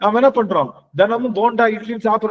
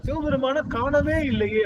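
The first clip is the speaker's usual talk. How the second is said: high-pitched imitation of a lament; retold wail; 6 semitones higher, 4 dB quieter than usual. Saint unable to see Shiva, retold wail. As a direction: plead high without raising the volume. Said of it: "அப்படின்னு கதறாரு".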